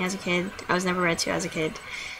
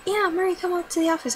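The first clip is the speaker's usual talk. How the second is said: imitating the vice principal, pitched higher; a lightly voiced, sing-song mock of the VP; 9 semitones higher than usual. Mocking the vice principal who calls her down, pitched higher. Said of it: "She's like".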